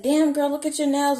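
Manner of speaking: high, loud mock admiration; loud, excited re-enacted compliment; re-enacted gushing. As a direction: gush it way up high, like a giddy flashback. Same gushing, acted out again.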